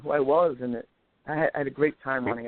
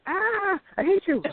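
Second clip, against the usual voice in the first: put-on kid's angry wail; slower than usual. Kid hit with a branch.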